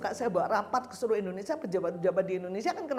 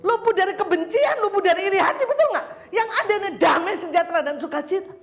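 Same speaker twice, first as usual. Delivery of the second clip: preaching at full force; 9 semitones higher, 10 dB louder than usual. Acted. Preacher rousing the congregation, loud and high. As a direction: drive the promise home to the congregation.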